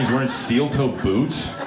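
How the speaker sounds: ordinary talk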